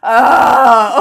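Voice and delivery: loud drawn-out "ah"; imitated weird noise. Imitating someone on a call making weird noises, loudly. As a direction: blurt a long, loud, silly moan. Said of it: "who was making weird noises during a call".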